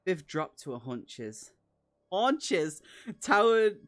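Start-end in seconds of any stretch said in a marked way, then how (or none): 2.1-3.9 louder, higher puzzled echo of the word; baffled repeat. Real, not acted. Reader stumbling on a word in the text, louder puzzled echo. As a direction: stop and query the odd word aloud.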